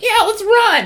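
high, eager yell; excited kid cheer. Retold or imitated. Kindergarten kids piling into the chase, high cheer. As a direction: cheer like a five-year-old joining in.

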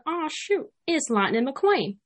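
drawn-out Southern country accent; Larry the Cable Guy. Shown as a demonstration. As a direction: kick off a folksy country drawl. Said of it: "get it started from Larry the Cable Guy".

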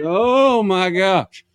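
drawn-out, high and loud dismay; alarmed reaction. Acted. Man hears his stomach rumble in the store, alarmed. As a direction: cry out as trouble rises.

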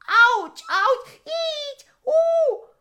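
high loud ouch cries; pained yelps. Acted. Stung tiger yelps in pain, high loud. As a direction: yelp as if stung.

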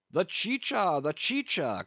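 a hint of a host calling for drink, first word high; host calling for drink for the faint storyteller; eager cry. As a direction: call out for the chicha to be brought. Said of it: "cried Don Pedro".

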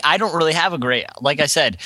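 ordinary talk, nothing marked

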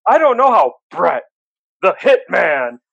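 big, drawn-out announcer call; ring announcer voice. Retold or imitated. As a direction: boom the name like a ring announcer. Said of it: "he did it in his announcer voice".

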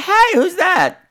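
high-pitched, puzzled call; mock curiosity. Man spotting woman on the grounds, mock-curious high call. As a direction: pipe up as if spotting a stranger.

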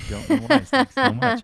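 high giggly laugh through words. Real, not acted. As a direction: laugh it off, embarrassed. Laughing after a burp.

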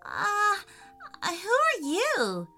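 put-on hesitant, high-pitched little boy; nervous. A narrator's reading. Boy meets a strange animal, nervously hesitant.

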